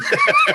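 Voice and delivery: a short high burst of laughter. Real, not acted. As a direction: laugh out at the joke. Laughing at Megan joke.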